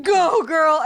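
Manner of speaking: loud, high hype-coach shout; boot camp instructor. Pretend. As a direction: shout encouragement like a fitness coach. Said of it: "a Barry's boot camp instructor".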